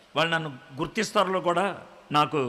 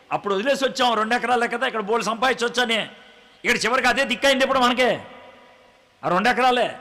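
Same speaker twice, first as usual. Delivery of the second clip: quick needling irony; 5 semitones higher, 4 dB louder, faster than usual. Acted. Interviewer mocks her wasted wealth.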